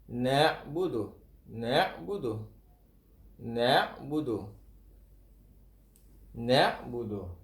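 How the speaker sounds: na'budu with a slight lilt on the dal; correct na'budu. Shown as a demonstration. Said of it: "Dia ada lenggok sikit". (sebut na'budu dengan lenggok sikit pada dal)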